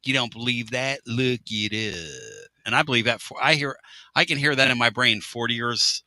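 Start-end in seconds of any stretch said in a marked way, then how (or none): 0.1-2.5 drawn-out "look it up" like the teacher; a mild take on the old teacher; shop teacher's "look it up". Retold or imitated. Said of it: "he'd go".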